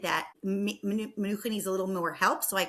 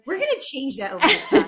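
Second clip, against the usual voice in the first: laughing, higher and louder tease; host teasing her guest while laughing; 6 semitones higher, 9 dB louder than usual. Real, not acted. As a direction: laugh through the playful threat.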